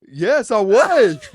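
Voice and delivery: loud, high, drawn-out yes; slow, booming confession; emphatic comic admission. Real, not acted. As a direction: proudly own up at full volume. Answers the dropped-on-head jab.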